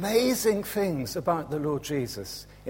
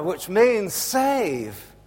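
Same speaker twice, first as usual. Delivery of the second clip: ordinary delivery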